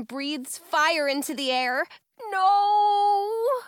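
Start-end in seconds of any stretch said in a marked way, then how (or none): 2.3-3.6 loud, high, drawn-out cry; alarmed cry. A narrator's reading. Girl sees dragon breathe fire, crying out in alarm.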